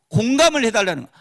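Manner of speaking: usual speaking voice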